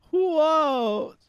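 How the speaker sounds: very high, loud startled whoa; surprised whoa. Real, not acted. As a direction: exclaim in sudden shock. Reacting to own crude joke.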